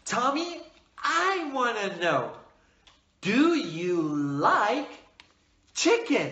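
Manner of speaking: slow, carefully spaced question; moderate speed. Shown as a demonstration. Teacher asking a young pupil, slow and measured. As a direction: pause between phrases so a learner can follow. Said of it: "Speak with a moderate speed".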